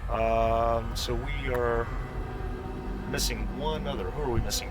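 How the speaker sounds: ordinary delivery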